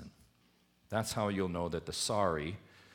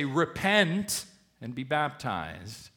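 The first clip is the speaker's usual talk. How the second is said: slow, higher and louder apostolic call; proclaiming preacher; 6 semitones higher, 6 dB louder, much slower than usual. Retold or imitated. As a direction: call the crowd to repent like Peter. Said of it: "They stand up and say".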